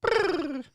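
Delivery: high rolled bird trill; bird noise. Shown as a demonstration. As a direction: copy a bird with a rolled brr. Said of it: "That close enough?".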